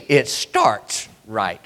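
fast lead-in then slow low drop; preacher drop. Acted. Preacher to congregation, building then dropping. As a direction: rush then sink into the line.

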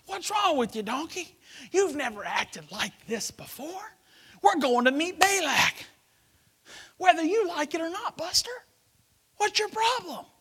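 high-pitched mock scolding; mock exasperated. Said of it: "So he's talking to his donkey".

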